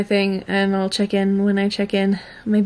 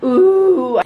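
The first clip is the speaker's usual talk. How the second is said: amazed; 3 semitones higher, 6 dB louder than usual. Real, not acted. Raw reaction to the room.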